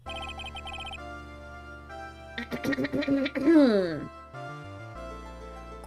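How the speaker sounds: long preparatory throat clear; throat clear ending in a hum. Real, not acted. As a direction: clear the throat before performing. Clears throat before judge's voice.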